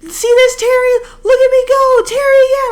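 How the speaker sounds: gloating friend. Retold or imitated. Best friend bragging to Terry mid-fight, in a high loud gloating voice. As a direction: crow with glee like she's winning. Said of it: "and she's like".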